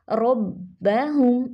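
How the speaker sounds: रब्बहुम very slow with heavy rolled रॉ and held doubled बा; slow tajweed. Shown as a demonstration. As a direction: stretch रब्बहुम, stop on the बा. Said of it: "रॉ पर ज़बर".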